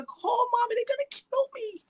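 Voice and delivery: high-pitched retold plea for help; mortified panic. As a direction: wail it as a retold cry of dread. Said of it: "I was mortified".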